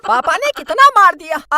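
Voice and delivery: ordinary talk, nothing marked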